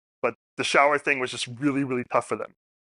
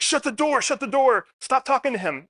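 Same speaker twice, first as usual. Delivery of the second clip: high, sharp barked order; urgent retold command; retold yell; 10 semitones higher than usual. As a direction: female nurse ordering the door shut. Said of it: "yells at the, our member".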